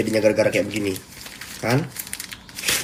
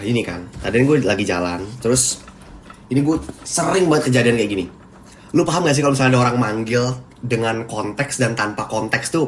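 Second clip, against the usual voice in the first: ordinary talk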